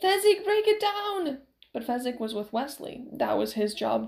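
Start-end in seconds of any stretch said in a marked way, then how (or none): 0.0-1.4 high, loud, slow urgent shout; desperate shout. A narrator's reading. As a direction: beg a friend to smash the door. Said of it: "he called out desperately".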